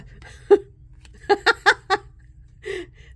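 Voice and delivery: loud, high belly laugh; real high-pitched laughing fit; helpless laughter. Real, not acted. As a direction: crack up completely. Cracking up over a salty card.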